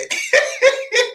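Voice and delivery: sudden high breathy burst of laughter. Real, not acted. Streamer laughing at a terrible Christmas dinner, high burst. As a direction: crack up at the plate.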